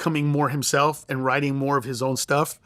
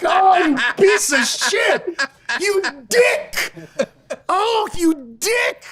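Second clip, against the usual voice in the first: high, loud, drawn-out mock cursing; mock outraged yell; 12 semitones higher, 5 dB louder, much slower than usual. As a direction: yell at the thief, laughing half through it. Retold outburst at joke thief.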